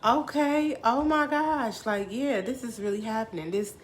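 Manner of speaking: high, loud disbelief settling into conviction; astonished realization. Retold or imitated. Storyteller realizing the sign is real, high excited astonishment. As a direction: cry out as it sinks in. Reacts to hearing the lyric confirmed.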